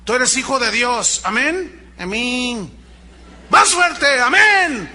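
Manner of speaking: high, loud call-and-response; hype preacher. Retold or imitated. Preacher mocking pastors who hype the congregation. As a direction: whip the church up mockingly. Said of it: "Meter la manipulación emocional".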